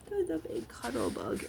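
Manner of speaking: affectionate coo. Real, not acted. Repeats fond phrase aloud.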